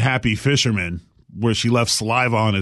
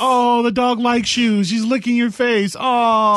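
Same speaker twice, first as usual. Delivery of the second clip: put-on high, slow cooing baby talk; doting dog owner; 8 semitones higher than usual. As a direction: gush over the dog, high and sweet. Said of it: "the dog's like".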